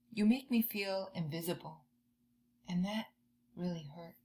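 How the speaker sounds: soft, fading confession of hurt; quiet hurt. Acted. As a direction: say it gently, letting it fade. Angry child explains hurt feelings.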